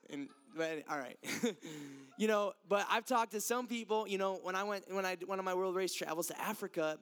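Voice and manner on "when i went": usual speaking voice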